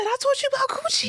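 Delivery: mother stunned her son quotes her; shocked retort. Retold or imitated. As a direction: squeak it in disbelief.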